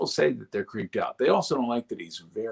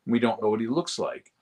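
ordinary delivery

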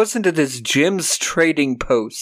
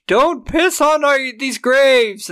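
high, louder mock shout of protest; 11 semitones higher, 3 dB louder, slower than usual. Thunder yelling at the construction workers, mock-outraged high shout. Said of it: "Thunder shows up and he's like".

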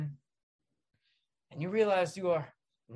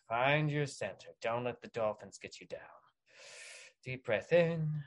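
softer, calm breathing coach; mock guided meditation; 6 semitones lower, 5 dB quieter than usual. Calming the listener after dolphins, soft mock meditation. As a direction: ease them down gently, half joking.

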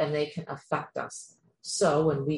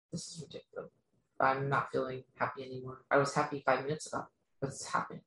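quiet inner voice; 2 semitones lower, 7 dB quieter, faster than usual. Retold or imitated. Voicing confused inner thoughts.

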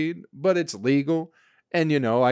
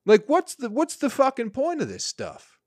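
exasperated; 12 semitones higher, 3 dB louder than usual. Real, not acted. Frustrated rant about weak product.

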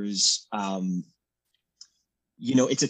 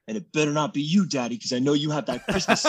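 higher, mock-stern child imitation; raspy little son; 6 semitones higher, faster than usual. Dad imitating son warning him about the suit, raspy. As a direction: play your son warning Daddy, laughter creeping in. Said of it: "he has this raspy voice".

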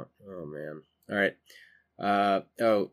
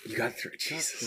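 whispering; 4 semitones higher, 4 dB quieter than usual. Real, not acted. Stunned reply to buying three.

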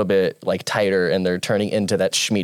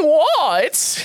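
put-on impersonation voice. Player feigns innocence to friend, high put-on voice. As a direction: squeak it out in mock innocence. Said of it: "Do you hear your voice?".

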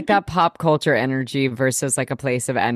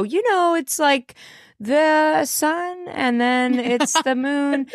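much higher, drawn-out hesitant mock explanation; unsure explaining; 11 semitones higher, slower than usual. Guest acting out her own attempts to explain the big three, unsure high voice. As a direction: fumble it in a high, slow, clueless voice. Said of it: "the way people ask me and I go".

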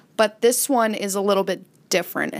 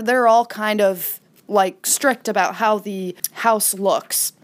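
ordinary talk, nothing marked

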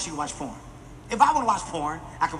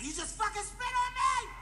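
high shrieking outrage; wife screaming in disbelief; shocked yell; 6 dB quieter than usual. Retold or imitated. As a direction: shriek it at him, stunned. Wife yelling after being spat on.

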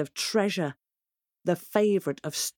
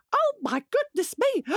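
flustered hen. Acted. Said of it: "Henny Penny voice".

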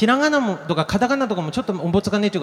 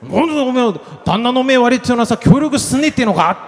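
raised-pitch mock scolding; angry husband; 5 semitones higher than usual. Husband berating his uncooperative wife, acted anger. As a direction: scold the wife in broad dialect. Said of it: "っていうふうに怒るわけですよ、".

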